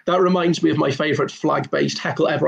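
usual speaking voice

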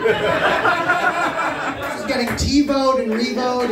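very slow laughing delivery; slow playful drawl; slow drawn-out pun. Pretend. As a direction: stretch out each word. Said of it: "wordplay drawn out".

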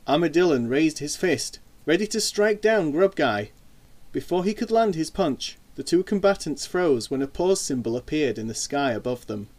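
plain reading voice

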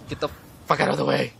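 fast, annoyed mock snap; imitated irritated snap. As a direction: rattle it off fed up. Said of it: "you're just like".

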